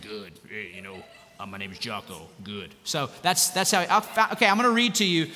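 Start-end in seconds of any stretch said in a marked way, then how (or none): 0.0-2.7 low, hushed manly-man impression; imitating a macho voice, low and soft; Jocko's manly voice. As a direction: drop deep and quiet like a tough guy. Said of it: "this just manly voice".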